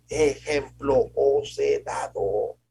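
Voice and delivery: very slow, drawn-out, with weight on every word; solemn slow quote. Acted. Preacher quoting Jesus to the brothers, very slow and solemn. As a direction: let each word land like scripture.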